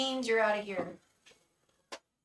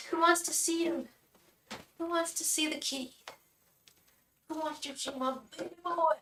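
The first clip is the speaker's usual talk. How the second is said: higher-pitched cutesy questions; pet talk; 6 semitones higher than usual. Real, not acted. Showing off the cat.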